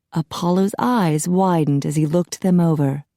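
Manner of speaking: ordinary delivery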